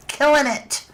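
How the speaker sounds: excited emphasis. Real, not acted. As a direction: punch the word up with delight. Repeats praise to chat.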